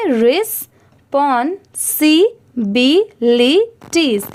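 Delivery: each syllable of respawn सी बी ली said slowly and held apart. Shown as a demonstration. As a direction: break the word into slow separate syllables, respawn, सी, बी, ली. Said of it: "अब इसे धीरे धीरे मिलाकर पढ़ेंगे".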